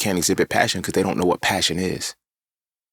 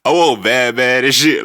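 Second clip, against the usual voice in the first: laughing; 6 semitones higher, 5 dB louder than usual. Real, not acted. Laughing about being a bad kid.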